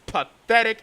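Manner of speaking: ordinary delivery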